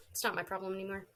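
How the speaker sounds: ordinary talk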